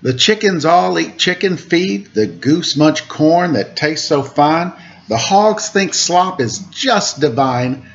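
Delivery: sing-song rhyming picture-book read; reading to children. A narrator's reading. Host reads a farm picture book to kids, lilting. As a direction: lilt the rhymes for little listeners.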